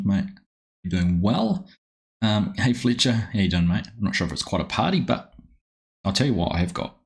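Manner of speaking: ordinary delivery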